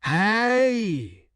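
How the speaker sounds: high, protesting call; startled cry. A narrator's reading. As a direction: cry out as if knocked off your feet. Thrown to the concrete, protests.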